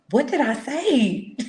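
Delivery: high, slow, laughing reproach; mock scolding. Big sister to little brother who misbehaved, mock scolding. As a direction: draw it out like a big sister telling him off.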